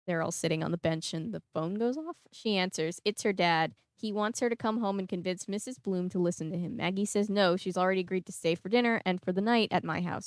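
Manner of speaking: plain reading voice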